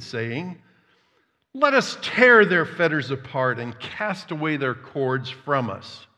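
loud, measured public Bible reading; declaiming scripture from the pulpit. Real, not acted. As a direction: proclaim the psalm to the room. Reads Psalm aloud to congregation.

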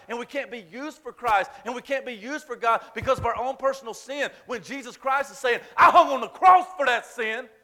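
rising, loud preacher's cry, pushing hard; preaching at full force. Acted. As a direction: preach it, pressing every phrase home.

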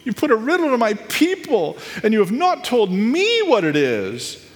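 hammed-up whiny reproach; mock weeping. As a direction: play a tearful wife pleading, pitch way up. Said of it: "wept over him and said".

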